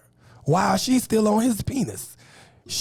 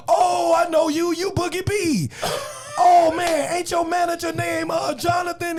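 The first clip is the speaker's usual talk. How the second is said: excited recognition; 10 semitones higher than usual. Retold or imitated. Comedian mimics the man recognizing him, high excited shout. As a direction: gush like a fan who spots a celebrity. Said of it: "He said".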